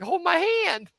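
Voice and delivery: high-pitched mock begging; whiny high voice at normal volume; imitated helpless plea. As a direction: mimic a tyrant turned helpless. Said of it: "went from tyrant to".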